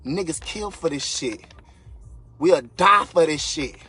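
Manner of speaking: loud, drawn-out, impassioned declaration; heated, emphatic holler; fired-up yelling. Real, not acted. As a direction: raise your voice and stretch it out. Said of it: "I'm yelling, I'm screaming".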